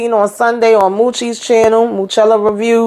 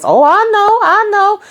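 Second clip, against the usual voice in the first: much higher, lilting mock giddiness; retold giddy reply; 11 semitones higher than usual. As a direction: play her giddy answer up high. Voicing giddy woman in recap.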